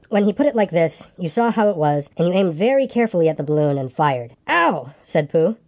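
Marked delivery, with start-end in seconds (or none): 4.5-4.9 high, falling "ow"; yelp of pain. A narrator's reading. Pooh is hit by the boy's shot, yelping. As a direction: cry out as if just hit.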